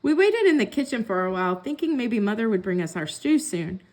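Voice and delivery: ordinary delivery, nothing marked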